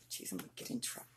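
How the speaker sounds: whispered aside to herself. Real, not acted. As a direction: whisper it under your breath. Aside after a slip.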